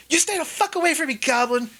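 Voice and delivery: put-on high, fast panicky kid voice; scared kid snapping. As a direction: spit it out fast and high like a frightened boy. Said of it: "Carp is just like".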